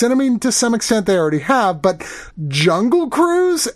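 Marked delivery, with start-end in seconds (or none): 2.5-3.7 incredulous. Real, not acted. Incredulous about the ride.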